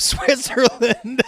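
high, slow reading breaking into laughter; real laugh bursting through; cracking up. Real, not acted. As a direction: lose it laughing on the word.

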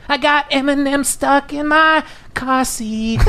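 high, slow, crooning mock lyric; sing-say it like a pop star; mock pop singer.

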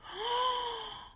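high breathy gasp of delight; playful swooning gasp; mock delighted gasp. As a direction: gasp as if moved. Touched by the graffiti.

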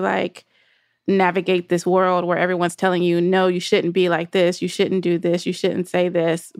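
ordinary delivery

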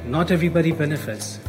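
ordinary talk, nothing marked

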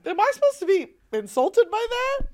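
high, loud puzzled question; baffled indignation. Retold or imitated. Growing up, told they look British, baffled and mock-offended. Retold reaction to looking British.

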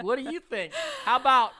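high, loud, mocking taunt; teasing hostile firebender; mock taunting Zuko. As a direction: jeer at him like a pitcher showing off.

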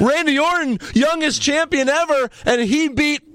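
high, hyped announcer imitation; recap announcer. Podcaster parodies edited wrestling recap, high announcer hype. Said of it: "edit Benoit out of all the recaps".

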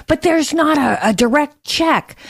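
mock media objection. Host mimicking left-wing media's excuse, mock protest.

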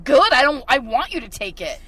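loud, defiant reply; woman telling the cop to take the pepper spray, loud and defiant; angry retort. Retold or imitated. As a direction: tell him off, still angry. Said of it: "And I'm like".

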